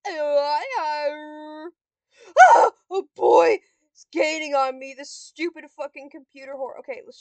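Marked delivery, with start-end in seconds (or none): 0.1-1.7 loud, drawn-out wail; long cry of defeat. Real, not acted. Losing tiles to computer. 2.9-4.9 loud cry of alarm; panicky, loud game reaction; alarmed shout. Real, not acted. Computer catching up.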